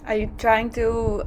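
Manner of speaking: ordinary talk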